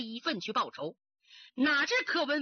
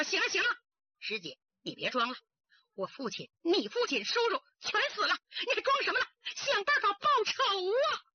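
high, rising furious demand; 愤怒质问; angry pleading; 6 semitones higher than usual. A narrator's reading. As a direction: force her to drop the act. Daughter confronts the sister.

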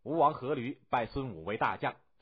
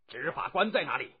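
stern, higher-pitched demand; stern shout; 4 semitones higher, 3 dB louder than usual. A narrator's reading. Angry general summons his law officer, stern shout. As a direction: call out sharply for the officer. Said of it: "厉声喝道".

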